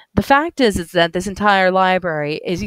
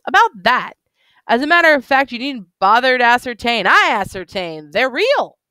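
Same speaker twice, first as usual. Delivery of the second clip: put-on higher, eager tipsy voice of an old bookish drunk; excited drunk man; 5 semitones higher, 3 dB louder than usual. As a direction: gush about the books like a tipsy fan. Drunk owl-eyed man voiced.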